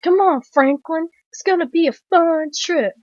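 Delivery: high put-on sing-out voice; mocking imitation. Film fan quoting a character's line, high mocking voice. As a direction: mimic the line well above your own pitch.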